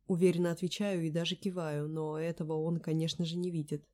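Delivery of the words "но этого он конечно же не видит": plain reading voice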